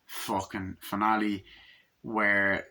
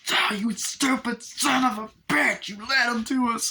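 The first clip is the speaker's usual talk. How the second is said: mock furious shriek; 14 semitones higher, 4 dB louder than usual. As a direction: shriek at him like she's hitting him. She starts hitting him.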